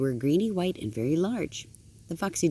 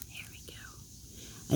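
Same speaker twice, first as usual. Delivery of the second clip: breathy little whisper to the babies; a grandmother's slow whispered sign-off; soft whisper; 14 dB quieter, much slower than usual. Real, not acted. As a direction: hush it almost to nothing. Reading to grandbabies.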